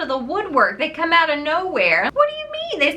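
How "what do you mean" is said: incredulous question. Real, not acted.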